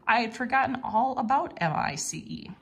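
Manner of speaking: usual speaking voice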